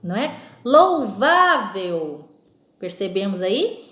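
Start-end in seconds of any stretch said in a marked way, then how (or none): 0.7-2.3 Louvável very slow, with a strong stretched VÁ; stress on VÁ. Shown as a demonstration. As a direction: hold the middle VÁ of Louvável, then fall. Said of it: "na palavra vá".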